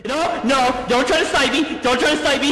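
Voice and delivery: panicked yell. Real, not acted. Dodging attack in game.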